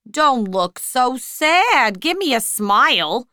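loud, slow, hearty cheer; jolly her out of it; cheerful waiter. A narrator's reading. Waiter cheering up the sad girl, loud and hearty. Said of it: "the waiter said cheerfully".

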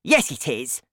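insistent put-on old woman; Grandma, shouting. A narrator's reading. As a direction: snap back as the grandmother. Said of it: "Grandma shouted".

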